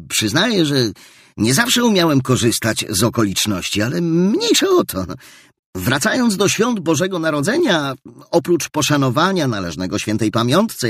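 put-on earnest young nephew. Nephew defends Christmas to his miserly uncle, earnest and rising. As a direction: speak up for the holiday with growing joy.

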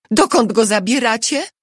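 high, anxious question; urgent demand. A narrator's reading. Wife confronts guards seizing her husband.

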